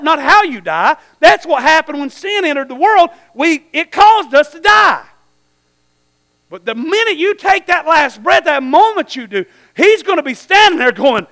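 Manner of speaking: usual speaking voice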